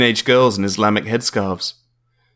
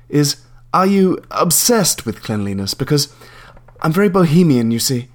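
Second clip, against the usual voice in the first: prim landlady; 5 semitones higher than usual. Acted. Landlady explaining herself.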